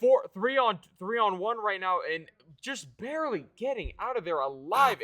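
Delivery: high, loud fast hype; excited. Real, not acted. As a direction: ride the fight's energy. Caster hyping a close fight.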